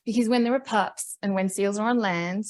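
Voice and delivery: ordinary talk, nothing marked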